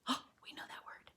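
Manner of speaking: quick delighted gasp, then a fast whisper. Real, not acted. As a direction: lean in and whisper the aside to the class. Aside to kids mid-reading.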